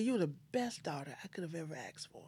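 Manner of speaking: hushed, fast whisper of the same words; soft breathy whisper. Retold or imitated. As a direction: whisper it through tears. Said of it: "She said".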